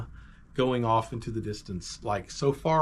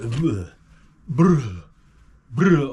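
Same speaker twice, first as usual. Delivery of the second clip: short, loud lip-rolled engine bursts; failed kick-start noises; imitated engine sputter; 8 dB louder, much slower than usual. Kid kicking a flooded bike, sputtering engine noise.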